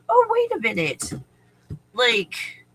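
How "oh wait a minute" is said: high, surprised oh wait; mock realization. Someone stuck in the matrix, high mock realization. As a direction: voice a sudden insight. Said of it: "instead of going".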